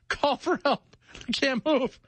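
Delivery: high, comic plea for help; mock helpless panic. Stuck bathroom scroller calling for help, high mock panic. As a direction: ham up a high-pitched distress call.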